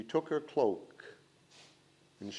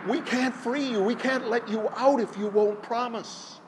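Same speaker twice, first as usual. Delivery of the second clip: higher pleading voices; anxious crowd; 9 semitones higher, 3 dB louder than usual. A narrator's reading. Villagers protest to the wind, higher anxious pleading. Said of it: "the people said".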